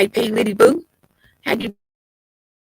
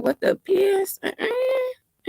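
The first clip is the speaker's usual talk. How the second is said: shocked outburst; 13 semitones higher, 5 dB quieter, much slower than usual. Retold or imitated. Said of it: "I said".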